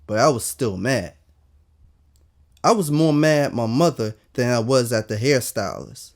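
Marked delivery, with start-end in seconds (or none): none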